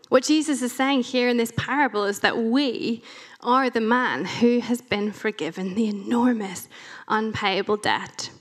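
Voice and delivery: ordinary delivery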